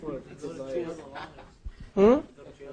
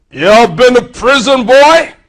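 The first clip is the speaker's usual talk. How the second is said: loud, demanding boss voice, higher; suspicious boss; 9 semitones higher, 13 dB louder than usual. Acted. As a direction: interrogate the job seeker. Acted employer questioning ex-con.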